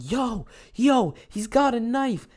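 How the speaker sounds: loud, high-pitched mock alarm yell; feigned panic. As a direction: yell a fake warning as if being chased. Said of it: "Just pretending like we're getting chased".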